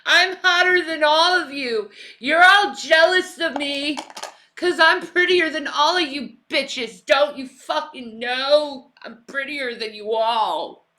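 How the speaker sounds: put-on high, loud vain mean girl; shrill catty stepsister. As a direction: sneer like a spoiled catty diva. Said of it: "That sounds like Effie".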